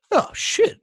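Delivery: high-pitched "oops" realisation; mock dismay. Retelling a hunter who was asked for the gun, mock dismay. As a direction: act out a sudden sinking realisation.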